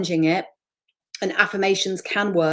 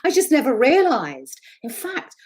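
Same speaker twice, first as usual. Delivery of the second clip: high, louder amazed confession; excited realization; 9 semitones higher, 4 dB louder than usual. Retold or imitated. A client telling the coach she found her self-talk, high and excited. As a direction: burst out with the discovery. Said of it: "she went".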